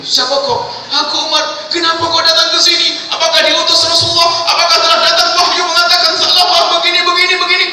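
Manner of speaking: high, loud, panicked questions from a scared hermit; alarmed Tha'labah. Acted. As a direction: fire the questions in fright. Frightened Tha'labah questions Umar. (Tha'labah ketakutan, suara tinggi dan keras)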